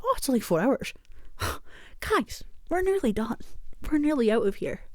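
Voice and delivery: pep up the tired crew; chirpy optimist. Retold or imitated. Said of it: "I'm like".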